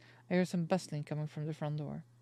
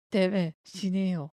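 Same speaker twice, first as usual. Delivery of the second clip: slow, much louder Korean-style curse; mock cursing; 3 semitones higher, 9 dB louder, much slower than usual. Real, not acted. Player curses the cruel father, slow loud fury.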